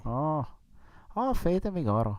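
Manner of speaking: high, soft leprechaun Irish voice; hammy Irish leprechaun lilt. Pretend. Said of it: "Sound like a leprechaun a little".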